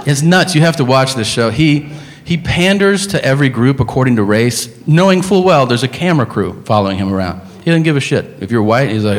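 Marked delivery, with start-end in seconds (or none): none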